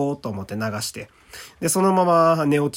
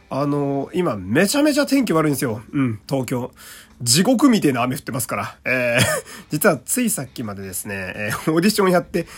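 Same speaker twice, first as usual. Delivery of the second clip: ordinary talk, nothing marked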